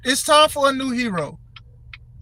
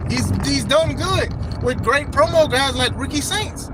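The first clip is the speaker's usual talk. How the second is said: ordinary delivery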